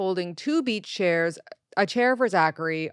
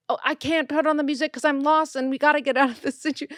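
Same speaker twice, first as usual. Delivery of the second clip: high, fast, louder whiny voice cut off mid-word; mock frantic husband; 8 semitones higher, 4 dB louder, much faster than usual. Mimicking her lost husband in a high frantic whine. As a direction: rattle it off like a panicking driver. Mocking husband's panicked excuse.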